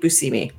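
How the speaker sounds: Buscemi as boo-SEE-mee; adopted pronunciation. Shown as a demonstration. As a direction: repeat Buscemi with a "see" middle. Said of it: "it's pronounced Buscemi".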